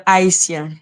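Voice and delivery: haïtien in French, silent H; French pronunciation. Shown as a demonstration. Explaining in French what her language says, French pronunciation. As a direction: say haïtien as ah-ee-syen. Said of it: "dans ma langue, on va dire".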